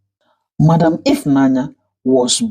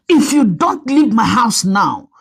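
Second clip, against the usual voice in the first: warning threat; 6 semitones higher than usual. A narrator's reading. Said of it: "Ifnanya warned".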